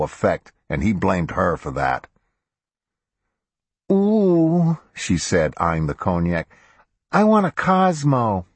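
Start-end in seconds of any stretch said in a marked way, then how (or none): 3.9-4.8 put-on high girlish ooh; girlish coo. A narrator's reading. Taylor's delighted sound. 7.1-8.5 put-on high, girlish request. A narrator's reading. Taylor asks for a drink.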